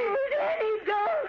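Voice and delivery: very high, frantic plea; terrified pleading. Acted. Mother sees son's grenade, terrified. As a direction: cry out to stop him.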